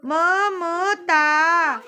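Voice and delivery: ममता drawn out very slowly, syllable by syllable; careful dictation of ममता; spelled out carefully. Shown as a demonstration. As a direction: say ममता slowly so each sound is clear. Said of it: "ममता का इन्होंने मम्मी लिख दिया".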